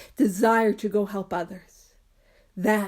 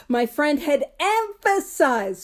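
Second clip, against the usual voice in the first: higher, slow, every word weighted; slow emphatic reading; 3 semitones higher, slower than usual. Shown as a demonstration. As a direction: lean hard on each word of "My friend had emphasized". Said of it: "so with emphasis".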